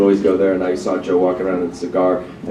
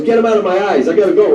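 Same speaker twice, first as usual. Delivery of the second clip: much higher, louder whiny impression of an insecure comic; Rodney complaining; 11 semitones higher, 5 dB louder than usual. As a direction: do the rattled comedian seeing his double. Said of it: "he goes".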